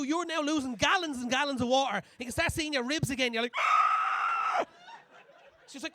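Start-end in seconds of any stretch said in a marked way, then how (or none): 3.6-4.6 panicked scream. Retold or imitated. Panicked while peeing in laneway.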